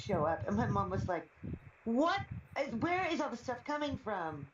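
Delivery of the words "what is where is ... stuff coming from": mock-astonished mom, pitched high; high, incredulous "what", imitating a baffled mother. Mother baffled by packages, retold.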